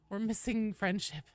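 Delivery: usual speaking voice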